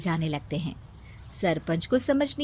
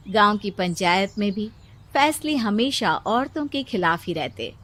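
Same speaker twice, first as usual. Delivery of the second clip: usual speaking voice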